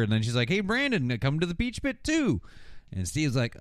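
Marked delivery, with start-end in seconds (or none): 0.5-2.4 high-pitched, sing-out call; mock eager invitation. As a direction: imitate a girl calling someone over. Imitates girl calling Brandon over.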